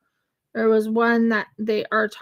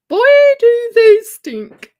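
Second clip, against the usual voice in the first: loud exaggerated; 13 semitones higher, 14 dB louder than usual. Exaggerating koala smell.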